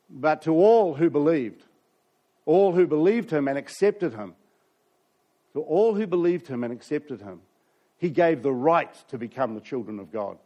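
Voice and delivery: building proclamation; emphatic scripture reading. Acted. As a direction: lean on every repeat.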